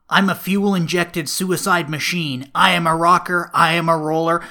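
loud, ranting movie boast; booming, mounting self-proclamation; Knight Rider. Acted. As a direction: declaim it like a crazed outlaw. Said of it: "From The Knight Rider, Mad Max".

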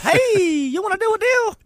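very high, squeaky mouse voice; hammed-up high-pitched cartoon mouse; squeaky excited mouse. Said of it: "squeaky like an excited mouse".

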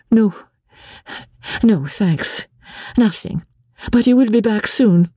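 wavering, holding on; anxious wife. A narrator's reading. Wife asking husband to return soon, anxious. Marguerite voiced higher.

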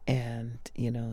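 ordinary talk

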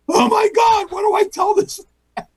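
very high panicked squeal; mock panic. Impostor fretting over Dick Clark, high mock panic. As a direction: squeal it as if caught out. Impersonator panics as the star stays.

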